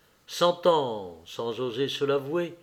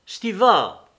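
higher, louder call of a name; sudden exclamation; 5 semitones higher, 7 dB louder than usual. A narrator's reading. Lévin suddenly calls to his hunting companion, exclaiming. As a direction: call out abruptly to your friend. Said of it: "s'écria tout à coup Lévin".

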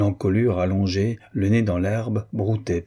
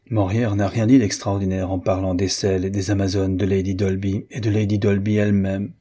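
plain reading voice

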